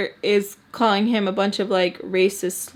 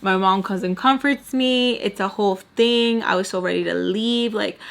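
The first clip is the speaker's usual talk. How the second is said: ordinary delivery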